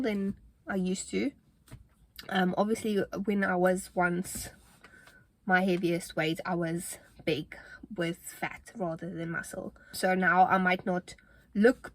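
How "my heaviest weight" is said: normal talking voice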